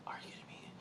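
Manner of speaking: barely audible whispered disbelief; retold exasperated aside, all whisper. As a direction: whisper it under your breath, in disbelief. Retold exasperated reaction after a sigh.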